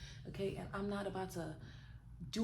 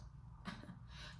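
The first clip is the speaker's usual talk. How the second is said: soft breathy laugh; 14 dB quieter than usual. Real, not acted. Laughing at her own joke.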